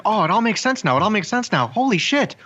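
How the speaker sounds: high, awestruck repeating; mock excited friend. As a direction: babble in amazed delight. Voicing William, friend's version.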